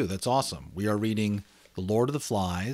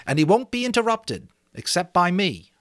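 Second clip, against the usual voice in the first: put-on higher, louder boy leader; Ralph; 10 semitones higher, 6 dB louder than usual. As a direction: lay it out to the boys. Ralph setting rules.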